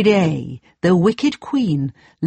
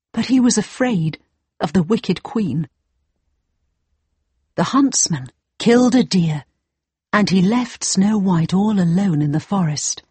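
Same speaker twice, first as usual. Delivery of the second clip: usual speaking voice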